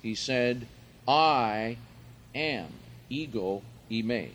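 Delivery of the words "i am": loud stressed I, pause, then AM; very emphatic. Retold or imitated. Jesus answers whether he is the Christ, very emphatic. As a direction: punch I and set AM apart after a beat. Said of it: "very emphatically says".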